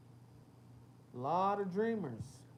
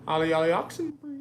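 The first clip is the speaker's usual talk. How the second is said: loud fast sing-song calling; playful call; 9 dB louder, faster than usual. Pretend. Calling chat out of hiding, loud playful call. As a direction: call it out like hide-and-seek.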